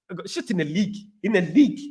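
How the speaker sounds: mock South African accent. Shown as a demonstration. Streamer replays his party-piece line for viewers, South African accent. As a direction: repeat the catchphrase in a put-on South African accent. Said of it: "I can only do the".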